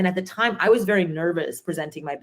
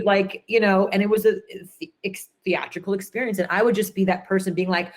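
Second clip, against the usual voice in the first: ordinary delivery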